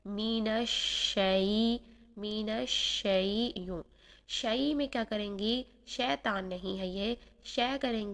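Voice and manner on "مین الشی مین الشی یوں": الشی with soft spread sh and voice running, slow; الشی, shee with ee; soft sheen. Shown as a demonstration. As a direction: glide through الشی gently. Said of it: "نرمی سے شین کو ادا کرنا ہے".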